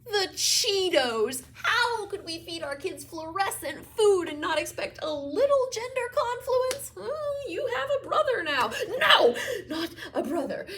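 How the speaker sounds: high, loud, theatrical exclamations; overwrought, breathless fuss; flustered outburst. Acted. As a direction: gush it high and loud, half laughing. Mother acting flustered revelation.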